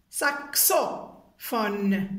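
SAXOPHONE slowly as sak, so, fone; syllable by syllable. Shown as a demonstration. Teacher rereading the completed word, syllable by syllable. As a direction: stretch saxophone out in separate syllables. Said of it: "Tapez avec moi les syllabes".

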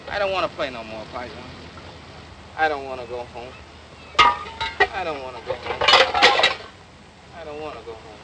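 soft, low and despairing; quietly heartbroken, giving up; sad and quiet. Acted. Despairing refusal after fantasy.

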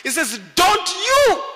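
normal talking voice

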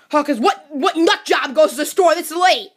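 ordinary talk, nothing marked

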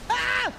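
high, theatrical cry of heartbreak; hammed-up pained cry. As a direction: overplay a wounded wail. Hammy heartbreak after failed hug.

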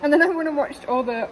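usual speaking voice

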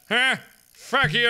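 ordinary delivery

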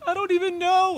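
put-on high, tearful little-girl voice; dad imitating his crying daughter in a squeaky voice; daughter's voice. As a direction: imitate a six-year-old who's crying for no reason. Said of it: "She's like".

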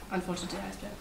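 plain reading voice

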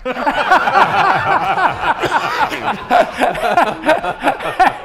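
big real burst of laughter; laughing hard, high and loud. Real, not acted. Host laughing at his own fumble.

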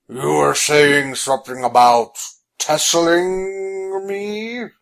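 loom over him slowly; menacing giant. Acted. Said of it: "the giant said".

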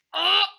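high, loud startled scream. Real, not acted. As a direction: cry out in shock. Sudden scare in game. (yüksek perdeden ani çığlık)

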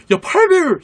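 loud frustrated shout at the find; excited shout. Real, not acted. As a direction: yell it out in disbelief. Finds a scope he can't use.

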